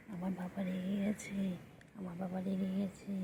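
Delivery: soft whispered aside about the pet. Real, not acted. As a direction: whisper it so the pet won't hear. (ফিসফিস করে বলা)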